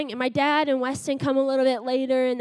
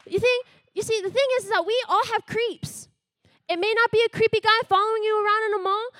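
raised, urgent sermon voice, pitch up; preaching; 6 semitones higher than usual. Acted. Youth speaker urging the congregation that fear traps them, preaching higher. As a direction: press the message home to the listeners.

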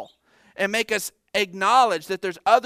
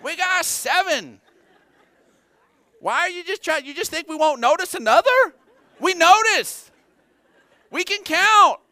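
higher, louder, laughing mock protest; joking complaint to extra kids, laughing and pitched up; mock exasperated dad; 9 semitones higher, 5 dB louder than usual. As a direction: playfully scold the kids for calling her mom. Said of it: "I'm like".

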